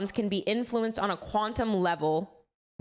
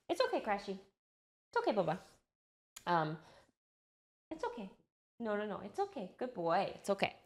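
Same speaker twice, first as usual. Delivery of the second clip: soothing a pet; 7 dB quieter than usual. Real, not acted. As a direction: coo gently and reassure him, much softer.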